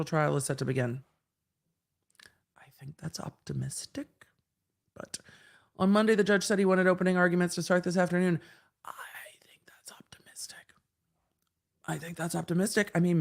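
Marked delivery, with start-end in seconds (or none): 2.6-5.2 whisper. Pretend. Whispered aside, repeated. 8.8-10.6 whisper. Pretend. Whispered repeat of the phrase.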